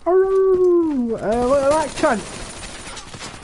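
very high, loud, slow outcry; outraged yell. Real, not acted. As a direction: bellow in disbelief at the killer. Outraged at being killed.

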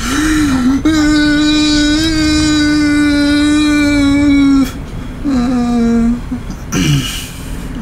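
high, long drawn-out wail of fright; long scream. Real, not acted. Gamer scared on the stairs, long high scream. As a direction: hold one high cry, then let it die away.

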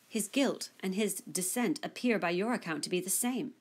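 plain reading voice

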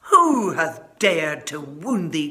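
slow, loud furious giant; enraged protective bellow; angry cry. Acted. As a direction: roar it slowly, outraged. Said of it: "cried the giant".

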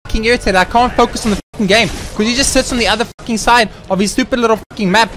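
loud, high exasperated rant; real irritation. Real, not acted. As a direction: rant at the unfair game, pitch up. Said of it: "I'm getting so irritated".

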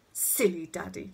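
put-on lightly scornful child; mild, scornful scolding. A narrator's reading. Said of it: "Connie looked scornfully at her father".

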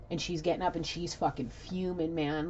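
ordinary talk

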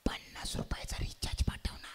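coaxing breathy whisper; wheedling girlfriend whisper. Acted. Girlfriend asking her boyfriend for phone recharge, coaxing whisper. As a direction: whisper sweetly to wheedle money.

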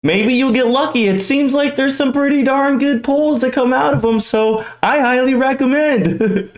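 high-pitched, upbeat pitch to viewers; giddy recommendation. Real, not acted. Collector recommending the card vending machine to viewers, giddy. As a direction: sell it with a high, giddy lift.

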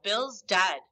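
lightly voiced gruff man calling out; narrated gruff call. A narrator's reading. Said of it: "a gruff voice call out".

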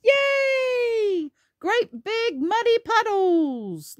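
long, high-pitched cheer; big celebratory storybook squeal; gleeful cheer. Acted. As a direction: draw every word out in high delight. Joyful climax of the story.

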